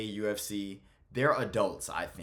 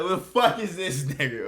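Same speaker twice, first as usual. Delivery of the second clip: high-pitched, louder baffled quote; higher, mock-incredulous voice; imitated bewildered reaction; 8 semitones higher, 7 dB louder than usual. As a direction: act out someone baffled by a stranger. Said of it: "He's like".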